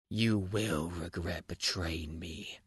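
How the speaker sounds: low, slow menacing threat; deep threatening voice. Retold or imitated. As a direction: growl it low and slow like a promise of revenge. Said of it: "told her in a deep, threatening voice".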